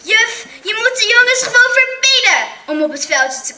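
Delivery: high, loud demand; shouting girl. A narrator's reading. Christel shouting at the teacher to ban the boys, high and loud. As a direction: yell it at the teacher. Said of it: "Christel schreeuwde het hardst".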